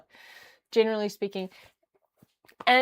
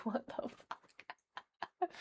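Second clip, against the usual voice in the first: slow, whispered disbelief; stunned whisper; 4 semitones higher, 10 dB quieter, much slower than usual. Retold or imitated. Reacting to her friend's charmed life, whispered disbelief. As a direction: breathe it out, amazed. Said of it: "And I'm like".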